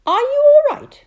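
put-on high, loud child's voice; little girl voice. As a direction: ask like a worried small girl.

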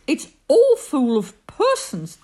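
loud, high protest; alarmed, forceful pleading; gasping in alarm. A narrator's reading. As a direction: object urgently, aghast. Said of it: "gasped Horton".

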